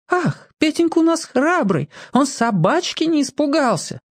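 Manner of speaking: high cooing grown-up praise; cooing adult. Retold or imitated. Petka recalls adults cooing praise, high and sweet. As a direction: coo it like a doting aunt. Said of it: "а не как-нибудь".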